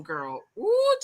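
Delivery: usual speaking voice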